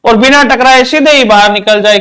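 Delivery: ordinary talk, nothing marked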